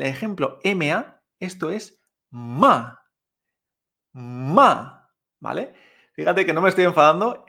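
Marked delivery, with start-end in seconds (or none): 2.3-5.1 "ma" cut short with a sharp fall; dry fourth tone. Shown as a demonstration. Teacher modelling the fourth tone, "ma" sharply falling. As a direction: drop "ma" abruptly as if cross. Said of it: "seco y hacia abajo".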